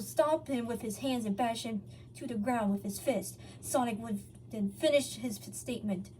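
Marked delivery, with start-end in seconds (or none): none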